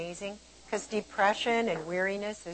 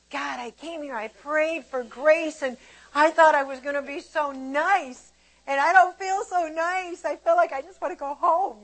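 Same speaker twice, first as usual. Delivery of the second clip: whiny complaint; 8 semitones higher, 6 dB louder than usual. Retold or imitated. Retelling a moan to God at the family camp, whiny complaint. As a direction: plead your case in an acted whine.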